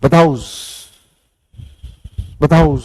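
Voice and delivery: "बताओ" with a long S stuck on the end; mock plural S. Shown as a demonstration. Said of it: "हर चीज के पीछे एस या ईएस लगा दो".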